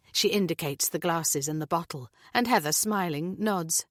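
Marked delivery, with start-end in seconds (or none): none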